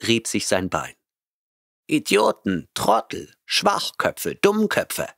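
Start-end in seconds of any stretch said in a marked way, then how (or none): 1.9-5.1 high, fond cat voice hurling insults; mock-affectionate name-calling from a cat; fondly insulting cat. A narrator's reading. Cat Oliver fondly insulting people, high cat voice. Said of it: "bemerkte der Paarkater liebevoll".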